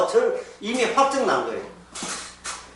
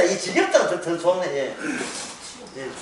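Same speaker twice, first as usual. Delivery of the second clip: ordinary talk, nothing marked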